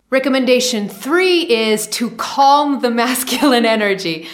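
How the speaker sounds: amused giggle mid-sentence; laughing. Real, not acted. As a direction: laugh while announcing the point. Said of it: "I say this laughing".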